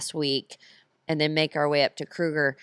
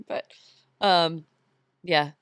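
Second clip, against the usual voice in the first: ordinary delivery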